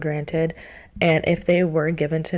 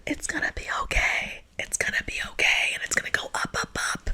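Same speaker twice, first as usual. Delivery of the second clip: whisper; 6 dB quieter than usual. Acted. Comforting the struggling viewer.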